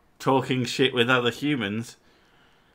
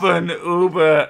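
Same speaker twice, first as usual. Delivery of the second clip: slowed-down German reading; 4 semitones higher, 7 dB louder than usual. Acted. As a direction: carefully sound out the German. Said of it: "do it in the softly spoken one".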